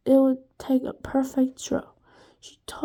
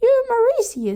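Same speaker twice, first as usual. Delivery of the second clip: much higher, louder Scottish voice; Scottish gentleman; 11 semitones higher, 5 dB louder than usual. A narrator's reading. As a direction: tell him who he is, Scottish. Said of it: "Jason sounded like the gentleman from Scotland".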